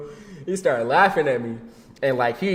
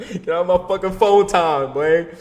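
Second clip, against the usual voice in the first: laughing exclamation; 4 semitones higher, 7 dB louder than usual. Real, not acted. Laughing exasperated punchline.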